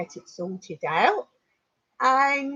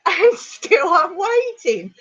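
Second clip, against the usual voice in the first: laughing; 9 semitones higher, 6 dB louder, slower than usual. Real, not acted. Laughing at own long wait.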